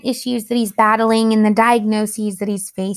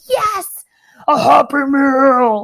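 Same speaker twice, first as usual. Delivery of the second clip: put-on much higher, squealing little kid voice, slowed and stretched; excited toddler; 3 semitones higher, 5 dB louder, slower than usual. Toddler son cheering about his Happy Meal, high squeal. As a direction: play the thrilled two-year-old. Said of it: "And he's like".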